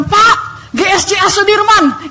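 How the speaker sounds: high, slow call to a driver. Retold or imitated. Passenger hails the minibus driver, calling out.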